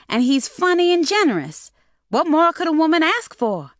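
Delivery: high, loud, nervous cover-up; flustered girl voice. Acted. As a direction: gush to cover a slip.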